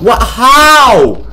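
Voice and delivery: loud, high cry of disbelief; exasperated high outburst; incredulous shout. Real, not acted. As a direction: shout it, baffled by the game. Reacts to bad game moment.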